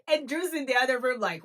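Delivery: ordinary delivery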